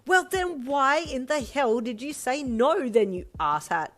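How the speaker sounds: loud, high, slow scolding; exasperated sister. A narrator's reading. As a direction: yell it in sheer exasperation.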